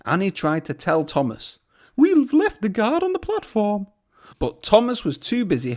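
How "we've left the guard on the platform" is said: much higher, anxious coach voice; high worried coach voice. A narrator's reading. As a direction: try to warn him. Annie warns Thomas.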